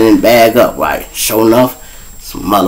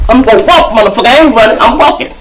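defiant; 10 semitones higher, faster than usual. Retold or imitated. Defiant retort to the cops.

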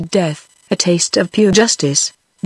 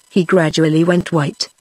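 plain reading voice

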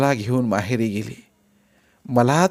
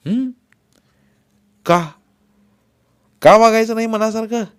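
sharp, louder and higher angry protest; angry retort; 9 semitones higher, 5 dB louder than usual. A narrator's reading. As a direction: snap back at the father in anger. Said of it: "मी चिडून म्हणालो". (चिडून, मोठ्या आवाजात)

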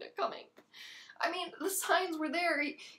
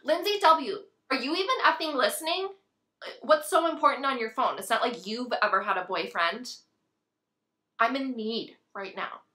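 loud, indignant telling-off; angry scolding; 5 semitones lower, 8 dB louder than usual. Acted. Scolding a friend who is on her phone, angry. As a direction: scold sharply, dropping lower as you go.

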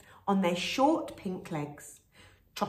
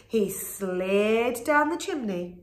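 ordinary delivery, nothing marked

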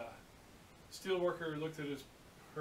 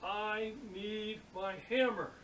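slow, higher, drawn-out exasperation; mock livid; 6 semitones higher, slower than usual. Steel worker repeating his signal to apprentice, exasperated. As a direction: stretch each word as if to someone not getting it. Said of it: "He's just livid".

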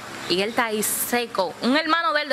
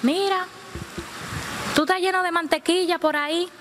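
lightly voiced girl, pitched higher; imitated girl; 8 semitones higher than usual. One of the girls confronting the smeared man, imitated girl. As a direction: point it out in a higher voice.

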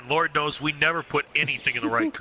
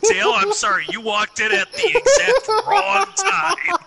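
high laughing apology; 6 dB louder than usual. Real, not acted.

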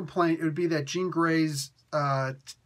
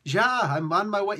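higher-pitched mock German accent; hammed-up German voice; crappy German accent; 5 semitones higher, 3 dB louder than usual. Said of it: "crappy German accent that we all have".

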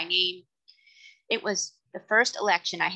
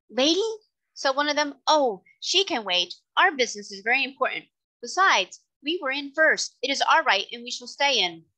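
indignant, higher and insistent; pushy, self-important gentleman; 6 semitones higher, 4 dB louder than usual. Acted. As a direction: brush the objection aside, pitched up. Voices a pushy election man.

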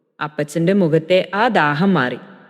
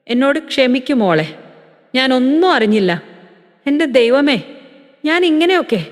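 mild crying, pitch climbing higher; tearful apology; 5 semitones higher than usual. Acted. Old man apologizing to his daughter-in-law, tearful. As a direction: beg forgiveness through light sobs. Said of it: "പൊട്ടിക്കരയാൻ തുടങ്ങി".

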